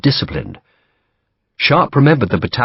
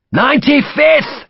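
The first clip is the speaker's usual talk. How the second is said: high, slow, called-out identification; shout; 4 dB louder than usual. Acted. Sharp shouting to stop friendly fire. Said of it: "he shouted".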